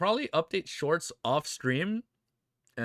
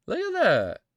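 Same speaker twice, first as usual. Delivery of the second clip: ordinary talk, nothing marked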